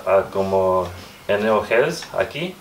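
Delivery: normal talking voice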